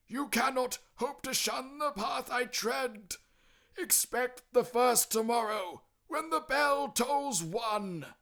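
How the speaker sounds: high, loud mournful spectre; anguished ghost lament, slowing at the end. Acted. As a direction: pour out regret like a damned soul. Ghost voice in story.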